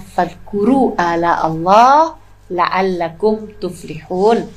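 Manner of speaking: slow recited Arabic; Quran recitation. Acted. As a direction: recite the verse slowly. Quoting a Quran verse. (membaca ayat perlahan seperti tilawah)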